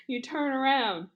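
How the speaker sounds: ordinary delivery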